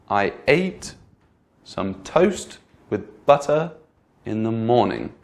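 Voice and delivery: "toast" and "butter" drawn out and lifted; slow down on each important word; sentence stress. Shown as a demonstration. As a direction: stress demo, slow and deliberate. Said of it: "What words to you sounded more stressed".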